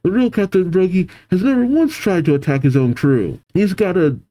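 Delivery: building high-pitched distress; alarmed pleading. Acted. Giants confronting demonic captain, high alarmed pleading. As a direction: plead with rising acted desperation.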